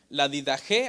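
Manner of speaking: usual speaking voice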